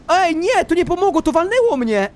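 high-pitched cry of dismay; real gamer's yelp of protest; dismayed outcry. Real, not acted. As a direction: cry out as the wave hits you. Hit by a wave in game.

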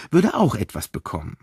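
ordinary delivery

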